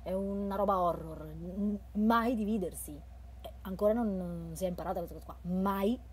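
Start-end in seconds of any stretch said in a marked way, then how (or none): 1.9-3.0 loud, stress on mai; emphatic rule. Acted. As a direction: hammer home the horror rule. Horror rule stated emphatically.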